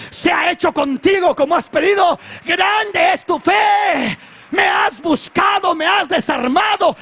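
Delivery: high, loud, slow declaration; full-force preacher's pronouncement; exultant proclamation. Acted. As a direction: proclaim with drawn-out shouts. Acted Jesus proclaiming woman's great faith.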